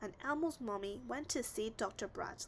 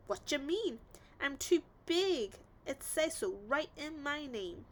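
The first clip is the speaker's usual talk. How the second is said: offended wolf; 4 semitones higher, 4 dB louder than usual. A narrator's reading.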